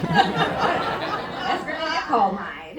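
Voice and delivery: slow laughing words. Real, not acted. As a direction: laugh through the line. Laughing at own story.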